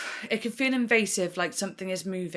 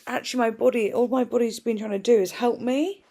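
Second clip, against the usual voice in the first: ordinary delivery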